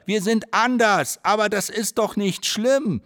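high, slow called reply; calling out. Acted. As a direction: call it out slowly and high. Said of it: "rufen wir". (hoher, langsamer Ruf)

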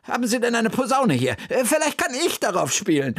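urgent plea. Acted. Bertha urging in crisis.

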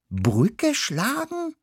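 high, slow, baffled mouse; puzzled mouse. Acted. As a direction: repeat it in disbelief. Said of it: "wiederholte ich".

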